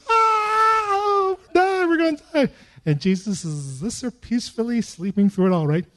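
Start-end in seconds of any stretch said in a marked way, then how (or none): 0.1-2.5 much higher, crying-out terror of a drowning disciple; mock panicked scream. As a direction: wail it like men sure the boat is sinking. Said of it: "There's disciples around you screaming".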